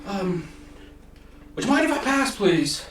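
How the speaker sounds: put-on high, loud squeaky old man asking politely; timid high voice begging to pass; old halfling asking the party to let his cart by, high and squeaky.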